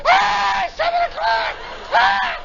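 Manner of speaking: very high, loud hammed-up screaming; real fighter-pilot panic. Comic demo of how fighter pilots really talk, panicked shrieking. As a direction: shriek the position in mock terror. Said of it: "hear the way they really talk".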